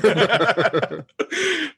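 sudden high-pitched laugh; high laugh burst. Real, not acted. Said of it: "I can't even say it with a straight face".

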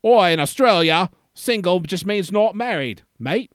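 lightly imitated Australian accent; a hint of Australian bloke. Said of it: "say something like".

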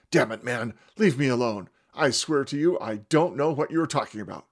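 loud, pleading curse; swearing in fear. Acted. Peter swearing he doesn't know Jesus. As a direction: swear at him, frightened and loud. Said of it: "I swore at him".

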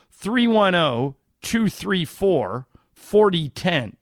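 each digit spaced out slowly; slow and deliberate. Acted. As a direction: read the number slowly for listeners. Spelling out phone number slowly.

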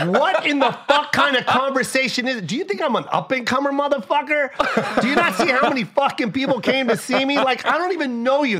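ranting back at a fan who acts like he did a favor; mock outrage. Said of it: "I'm like".

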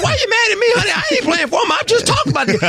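high, laughing protest to his wife; exasperated husband. Retold or imitated. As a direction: plead with a laugh, half shouting. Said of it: "And I said".

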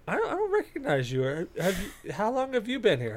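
high-pitched mock woman's voice; imitating a woman customer. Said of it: "the woman goes".

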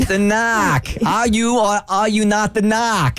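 put-on thick Boston accent, drawn out. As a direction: drop the r like a Boston cop. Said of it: "the accent is so thick".

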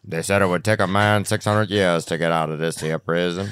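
low, measured film narrator; Morgan Freeman. Acted. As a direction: tell it as a wise, folksy storyteller. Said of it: "let me try it again".

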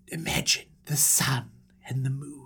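conjure it softly and mysteriously; hushed awe. Acted. Dreamer unveils sun and moon, hushed and awed.

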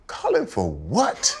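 high-pitched, drawn-out incredulous comeback; retold indignation. Former player refusing to call the GM, mock indignation. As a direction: act out a scandalized refusal.

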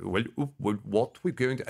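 halting fake Swedish accent; bad Swedish accent. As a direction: stumble into a terrible Swedish accent. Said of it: "give me a Swedish accent".

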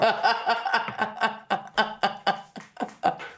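long, high-pitched real laugh. Real, not acted. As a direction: burst out laughing at your own joke. Laughs at own joke.

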